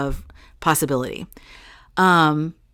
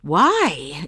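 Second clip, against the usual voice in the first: high, rising incredulous cry; astonished mock outburst; blown-away disbelief; 3 semitones higher than usual. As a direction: re-enact being stunned, pitch swooping way up. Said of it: "I was blown away by that".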